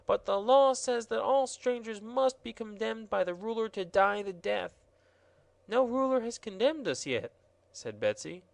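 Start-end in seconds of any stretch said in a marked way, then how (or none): 0.1-4.8 mock-tearful gardener, much higher; weeping. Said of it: "beginning to weep real tears". 5.7-7.3 put-on high, bright girl's voice, curious; a little girl asking eagerly; child's voice, much higher; young girl Betsy.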